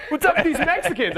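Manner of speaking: very high mock stand-up voice; parodied hack comic. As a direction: exaggerate the cheesy joke setup. Repeats the hack comic opener.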